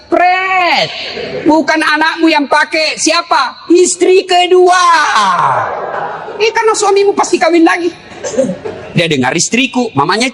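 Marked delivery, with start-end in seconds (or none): 0.1-7.9 mocking retort. Real, not acted. Preacher answering the rich wives with a mocking shout.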